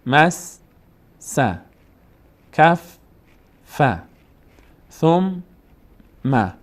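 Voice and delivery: مس، س، کف، ف، ثم، م with a pause after each; first syllable high, second cut off separately; split syllables. Shown as a demonstration. Teacher showing the original doubled letters, syllable by syllable. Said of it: "اصلشون اینطور بوده".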